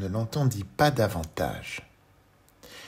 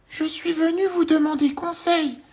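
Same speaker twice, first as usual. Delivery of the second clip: very high, louder little voice; tiny Monsieur Petit; 7 dB louder than usual. Acted. Tiny man greets the worm, very high voice.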